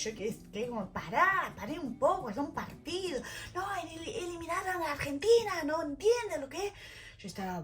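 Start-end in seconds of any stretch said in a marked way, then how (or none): 0.9-3.2 higher-pitched appeal to calm down; retold protest. Telling furious host family it's a match, protesting. 3.6-6.7 high-pitched indignant protest; imitated outrage. Angry host family mourning Argentina's exit, mock outrage. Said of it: "estaban re enojados".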